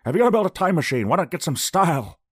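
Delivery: fast, loud, high movie-scientist voice; hammed-up film character impression; Doc Brown. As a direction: declaim it rapidly with excited force. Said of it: "what Doc Brown says in Back to the Future".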